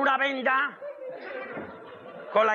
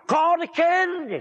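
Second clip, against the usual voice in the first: calling out; 5 semitones higher than usual. Acted. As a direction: call the boy's name from afar. Father calls out to his son.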